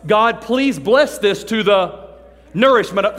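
slow, loud, pompous grace; mock fancy prayer. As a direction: ham up a showy mealtime prayer. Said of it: "the nifty prayer you're gonna pray".

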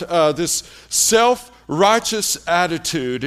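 ordinary talk, nothing marked

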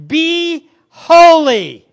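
very loud, high, drawn-out order; booming command. Acted. Preacher delivering God's command, booming and slow. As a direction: proclaim it slowly at full force. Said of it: "there's the command".

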